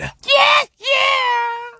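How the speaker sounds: high shouted yeah yeah; excited cheer. Real, not acted. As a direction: hype it up like a crowd. Hyped intro cheer.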